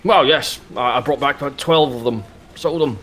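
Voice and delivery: put-on high, stiff old soldier; a proud veteran sizing up a recruit; old army man voice. Mr Muntz voice.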